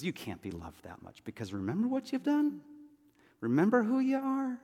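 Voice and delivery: accuser's leading question, swooping up high; Satan's taunting accusation. Acted. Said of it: "when Satan tries to throw those things at you, he says".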